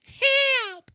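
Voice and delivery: high squeaky mock cry; mock cry for help. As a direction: squeal helplessly, hammed up. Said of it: "She's just like".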